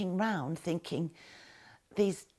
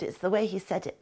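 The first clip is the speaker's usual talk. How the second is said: ordinary delivery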